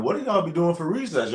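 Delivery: high, puzzled question; amazed kid. Retold or imitated. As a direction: ask in disbelief like a kid. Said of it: "I'm like".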